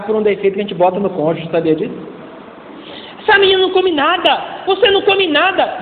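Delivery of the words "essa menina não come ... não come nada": higher, nagging parent voice; a hint of an exasperated mother; complaining parent. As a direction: parent complaining about picky daughter, higher. Parent labelling child.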